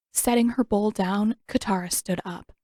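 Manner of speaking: ordinary delivery, nothing marked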